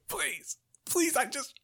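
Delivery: high, strained strangled voice; choked pleading. Acted. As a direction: beg while being throttled. Strangled man begs for mercy.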